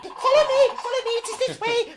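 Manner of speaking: very high, loud squeaky pixie voice; tiny pixie. Acted. Pixie guiding the giant out, very high and squeaky. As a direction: chirp it like a buzzing little fairy. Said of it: "Apple Glimmer is just like".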